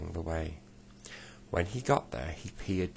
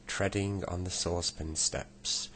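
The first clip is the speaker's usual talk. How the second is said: ordinary delivery, nothing marked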